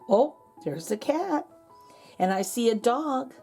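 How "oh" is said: ordinary delivery, nothing marked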